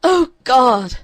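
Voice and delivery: loud, high despairing cry; anguished cry. A narrator's reading. Girl alone in her locked room, anguished cry. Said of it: "she cried".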